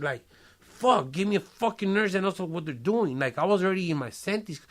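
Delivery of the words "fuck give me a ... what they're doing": retold pained yell, higher and louder; angry yell. Patient cursing the nurse who keeps poking him, angry yell. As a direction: snap at the nurse in pain and anger.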